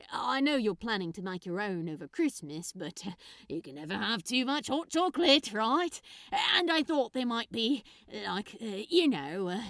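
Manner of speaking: fast, loud, nervous rambling; rambling Crowley. Acted. Nervous giver justifying his present, fast rambling. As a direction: babble to fill the silence.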